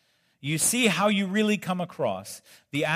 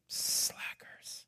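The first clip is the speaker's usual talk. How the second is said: a dry whispered jab; whispered aside; 12 dB quieter than usual. Real, not acted. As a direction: whisper it under your breath. Aside mocking sleeping disciples.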